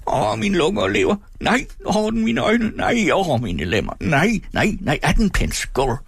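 gust of wind swelling high and dropping away; very high anguished haggling whine; rising, falling wail. A narrator's reading. Old man haggles over the price, wailing in gusts. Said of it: "der mindede om et vindstød".

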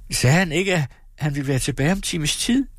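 horrified she could sleep now; alarmed mother. Acted. Mother to daughter, shocked and distraught, high. Mother asks anxiously about doctor.

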